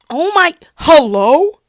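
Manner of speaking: slow, high and loud startled hello. Real, not acted. Startled by clanking blueberries while filming.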